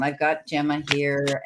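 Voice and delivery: ordinary delivery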